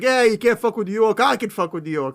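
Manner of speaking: loud, lightly put-on New York accent; New York swagger, punched up front; New Yorker. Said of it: "he's almost like a New Yorker".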